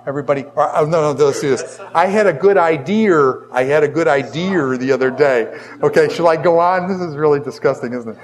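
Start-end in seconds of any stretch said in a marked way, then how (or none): 1.9-5.6 Boston accent with an R added to "idea"; broad Boston demo. Shown as a demonstration. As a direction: demonstrate a Bostonian saying "idea" as "idear". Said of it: "I'm from Boston when I say idea".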